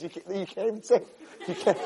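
ordinary delivery

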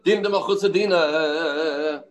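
Dina de Mechusar Dina drawn out slowly, each word leaned on; slow, weighty quote of the famous line. Shown as a demonstration. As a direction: savour the famous principle, slowly. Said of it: "Ah, famous bar".

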